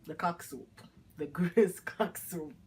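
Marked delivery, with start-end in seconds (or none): 1.2-2.5 a real laugh breaking through the words, slowed right down; laughing through the dish name. Real, not acted. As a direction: chuckle your way through the name. Laughing while naming the soup.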